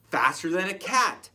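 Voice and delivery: ordinary delivery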